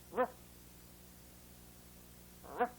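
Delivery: ruff growled like a little dog; mean puppy dog sound. Shown as a demonstration. Teacher showing the R sound as a puppy growl, ruff. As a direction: say ruff as a short growly bark. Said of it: "sounds like a mean puppy dog".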